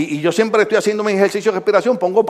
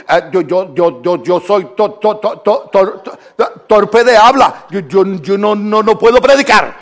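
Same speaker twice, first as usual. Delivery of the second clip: mock stutter, repeating yo and to-; stammering Moses; 3 dB louder, slower than usual. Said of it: "le dice Moisés a Dios". (Moisés tartamudeando, torpe de habla)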